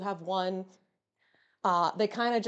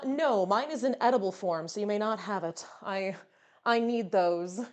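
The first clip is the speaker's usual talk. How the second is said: ordinary talk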